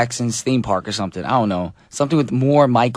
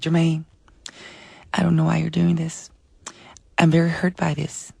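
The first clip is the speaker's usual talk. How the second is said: higher, lightly voiced hurt pop star; a gentle, wounded plea, pitched up; 6 semitones higher than usual. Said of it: "That's my [name] impression".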